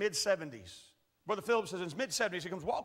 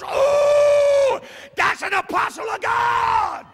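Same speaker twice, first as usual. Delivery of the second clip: awed shout; 13 semitones higher, 13 dB louder, much slower than usual. Retold or imitated. Old man awed at the preacher.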